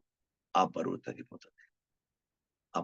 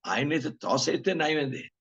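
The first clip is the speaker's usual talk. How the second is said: ordinary delivery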